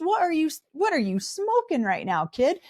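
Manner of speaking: high-pitched sarcastic comeback; incredulous retort. Retold or imitated. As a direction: scoff at her hypocrisy. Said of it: "I'm like".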